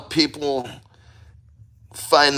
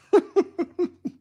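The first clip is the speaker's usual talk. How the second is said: high gasping laugh; shocked laugh from the cold. Real, not acted.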